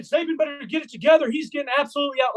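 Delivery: high-pitched retold heckle; heckling taunt. Retold heckling of rival coach.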